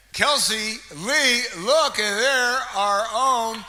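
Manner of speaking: drawn-out high, loud call of the name; calling out winner. Real, not acted. Emcee announcing a door prize winner to the crowd, drawn-out loud call. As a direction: sing the name out slowly across the room.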